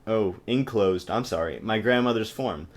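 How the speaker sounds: normal talking voice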